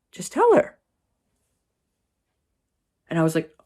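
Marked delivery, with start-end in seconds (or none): 0.1-0.8 very high, squeezed sister voice; urgent prompting. Retold or imitated. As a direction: squeak it as an urgent aside. Sister prompts her before mom.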